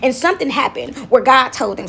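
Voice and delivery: usual speaking voice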